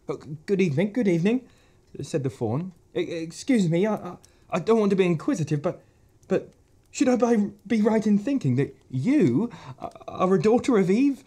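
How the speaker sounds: high, stuttering nervous fawn voice; a shy creature tripping over his words; stammering fawn. Acted. Fawn nervously greeting a girl, high stammer.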